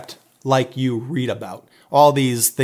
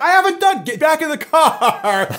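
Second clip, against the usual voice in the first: laughing retelling; 13 semitones higher than usual. Retold or imitated. Protest cut off by order.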